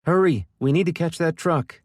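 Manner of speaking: high, loud urgent yell. A narrator's reading. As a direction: yell at them to hurry. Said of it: "she yelled".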